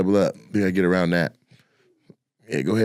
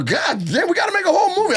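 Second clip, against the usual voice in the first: shocked exclamation; faster than usual. Retold or imitated. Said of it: "He was like".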